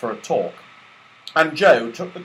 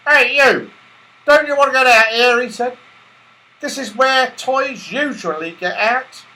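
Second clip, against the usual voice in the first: loud, high, fast porter call; gruff porter; 12 semitones higher, 5 dB louder than usual. Acted. Porter waking the toys, loud and brisk. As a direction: wake sleepers bluntly.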